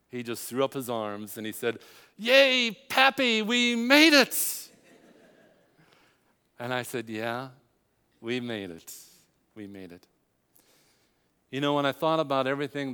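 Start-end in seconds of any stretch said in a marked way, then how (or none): 2.2-4.7 put-on much higher, louder cheer of a little boy; imitating a grandson's gleeful yay, slowing on we made it; child's excited cheer. Grandson throws up his arms. 7.1-10.1 relieved quiet reply. Retold or imitated.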